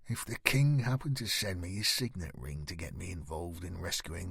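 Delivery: low, soft, steady warning; a hushed edge of threat; quiet voice. Acted. Knight quietly warning the boy he may leave. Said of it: "John went on in that quiet voice".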